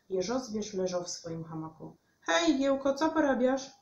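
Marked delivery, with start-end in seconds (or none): 2.2-3.7 high, loud friendly hail; calling out. A narrator's reading. Witek greets the hedgehog in his hammock, calling out. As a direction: call over to a friend.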